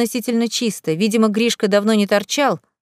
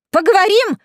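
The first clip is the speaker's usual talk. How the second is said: high, loud incredulous squeal; outraged shriek; 10 semitones higher, 5 dB louder than usual. A narrator's reading. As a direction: throw the word back in a high shriek. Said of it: "взвизгнула Лена". (возмущённый взвизг)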